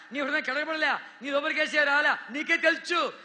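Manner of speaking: loud, pleading sermon voice; God pleading, preached hard; full-force preaching. Acted. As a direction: urge the listener to repent. Preacher voices God's plea.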